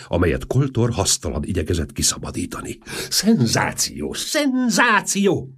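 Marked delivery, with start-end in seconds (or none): 3.1-5.5 slow, stretched-out cry, the second much higher; roaring out a sensation; yelling. A narrator's reading. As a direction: bellow the news with glee. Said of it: "ordította".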